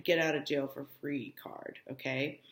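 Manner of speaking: usual speaking voice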